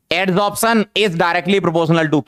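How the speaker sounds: very slow, word-by-word note dictation; dictating a line for the notebook, drawn out. Shown as a demonstration. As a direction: spell it out slowly so they can write.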